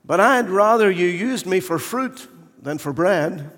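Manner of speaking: high, loud polite-but-picky voice; fussy wooden bowl. Acted. Wooden bowl bargains with the master, high and fussy. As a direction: offer yourself, with conditions. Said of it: "the wooden bowl said".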